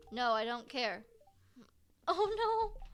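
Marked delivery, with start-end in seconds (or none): 2.1-2.8 loud high "oh no"; real dismay at losing hearts; dismayed cry. Real, not acted.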